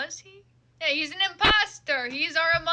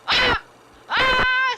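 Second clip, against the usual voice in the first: angry yelling; 4 semitones higher, 6 dB louder, slower than usual. Acted. Character yells at pigeons.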